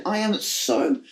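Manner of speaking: ordinary delivery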